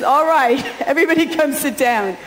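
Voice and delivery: usual speaking voice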